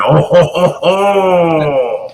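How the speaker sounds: hammed-up French accent. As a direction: put on a comic French "oh ho ho". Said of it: "you had the French accent".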